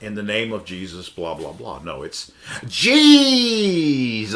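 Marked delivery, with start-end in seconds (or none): 2.7-4.4 high, loud, long-held mock healer wail; theatrical faith healer. Showing the congregation the healers' theatrics, mock theatrical cry. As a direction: stretch the name out with hammed-up hype. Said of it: "have to say it like that".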